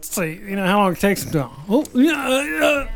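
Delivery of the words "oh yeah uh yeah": high, hesitant put-on phone voice; mock fumbling to waste their time; put-on stalling victim. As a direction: play a dithering caller, pitched up. Acts stalling the scammer on phone.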